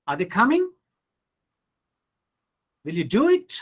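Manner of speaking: yes-or-no questions climbing high at "coming" and "it"; rising question tone. Shown as a demonstration. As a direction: sweep sharply up on "coming" and on "do it". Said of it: "You can use rising tone".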